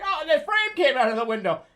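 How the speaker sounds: high, loud wail of mock dismay; mother's dismay. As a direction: cry out like a flustered mother. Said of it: "and she's like".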